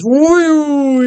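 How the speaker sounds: sudden squeaky whoop, way up high; high falsetto woo. Retold or imitated. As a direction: shriek it in a silly high voice. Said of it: "he goes".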